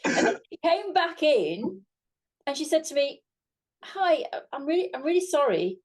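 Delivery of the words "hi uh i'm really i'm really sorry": lightly voiced hesitant, higher-pitched fan; imitating an awkward, high-pitched apology; mock apologetic fan. Mistaken fan asks presenter who she is, sheepish imitation.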